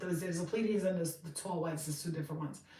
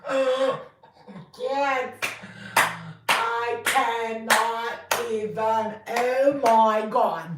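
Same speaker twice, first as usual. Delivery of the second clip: shocked gasp; 3 semitones higher, 9 dB louder, much slower than usual. Acted. Reader shocked at the tarot card.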